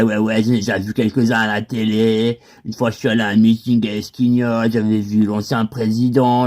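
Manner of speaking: ordinary talk, nothing marked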